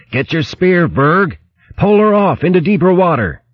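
high, pressing orders under fire; urgent command. Acted. Captain ordering mate to pole off under attack, urgent high call. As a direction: push the pitch up and order sharply.

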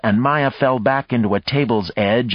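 plain reading voice

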